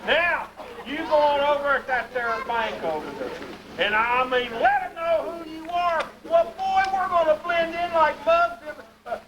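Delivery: proud country pa showing off the big town; Pa bragging to his backward boys; hillbilly Pa. Acted. Pa urges son to bank.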